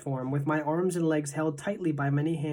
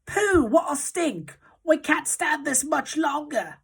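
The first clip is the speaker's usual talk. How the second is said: disgusted witches' cries; 11 semitones higher, slower than usual. A narrator's reading. Witches complaining about the boy's stink, in high disgusted cries. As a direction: whine it out, holding your nose. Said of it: "were cries of".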